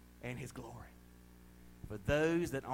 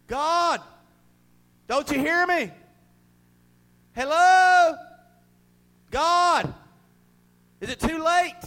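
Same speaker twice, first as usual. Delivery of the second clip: much louder, higher desperate calling out; desperate plea; 12 semitones higher, 14 dB louder, slower than usual. Acted. Preacher voicing a lost soul calling to God, desperate and loud. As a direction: cry out to a God who won't answer.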